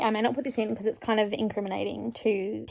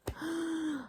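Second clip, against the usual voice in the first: quick shocked intake of breath; soft high gasp of shock; sharp gasp; 8 semitones higher, 10 dB quieter than usual. Real, not acted. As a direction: gasp as if caught off guard. Shocked by line read aloud.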